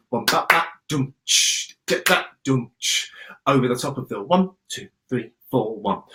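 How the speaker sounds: vocalised drum rhythm; beatbox-like counting; scatting the rhythm. Shown as a demonstration. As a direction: sing the rhythm with syllables. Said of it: "add that third rhythm".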